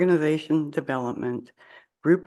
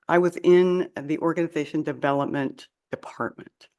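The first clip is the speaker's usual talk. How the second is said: normal talking voice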